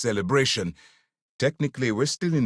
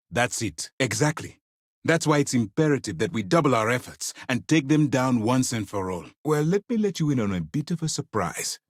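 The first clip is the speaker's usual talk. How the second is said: ordinary delivery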